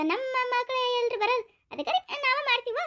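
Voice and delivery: high, laughing through the words; laughing proudly. Acted. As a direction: laugh it off with pride. Bride's mother laughs proudly.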